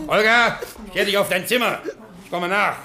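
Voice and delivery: höher gesetzter, strenger Befehlston; strenger Befehl. Acted. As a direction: schickt sie barsch aufs Zimmer. Boss befiehlt Olga. (strenger Befehl an Olga)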